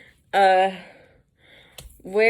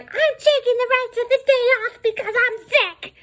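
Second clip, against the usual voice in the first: loud, very high screechy outburst; mock tantrum in piercing falsetto; mad supervisor; 5 dB louder than usual. Supervisor storming out for the day, mocked angry screech. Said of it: "that she got mad".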